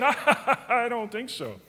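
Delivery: slow, laughing no; laughing, slowed mock defiance to the pastor; laughing refusal. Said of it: "And I said".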